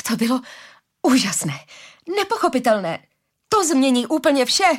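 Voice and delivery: high, forceful burst of enthusiasm; excited calling. A narrator's reading. Harari calls out to Arila after landing, excited. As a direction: call it out with wonder, pitch climbing. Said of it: "volal Harari nadšeně".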